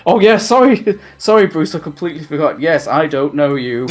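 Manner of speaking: flustered apology. Retold or imitated. Terry babbling sorry to Bruce, hammed-up and flustered. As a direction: gush the apology like a kid caught out.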